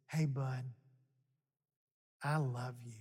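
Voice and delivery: soft, low, loving murmur; tender. Acted. God softly telling tough Elijah he loves him. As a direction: say it like a friend's hug.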